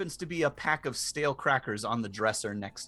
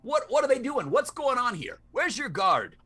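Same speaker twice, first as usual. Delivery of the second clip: guard voice; 10 semitones higher, 3 dB louder than usual. Acted.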